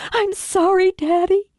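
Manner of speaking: high, distraught apology; anguished daughter. Acted. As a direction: apologise in high, desperate grief. Daughter apologises to dying father.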